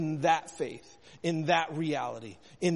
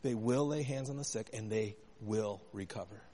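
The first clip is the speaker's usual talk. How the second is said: quiet emphasis; 5 semitones lower, 6 dB quieter than usual. Shown as a demonstration. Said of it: "say it this way".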